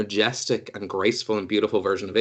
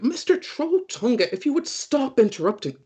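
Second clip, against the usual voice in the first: distracted Rua; 11 semitones higher than usual. Rua scolds an invisible Trolltunga, high and distracted. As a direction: speak to someone unseen. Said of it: "And goes".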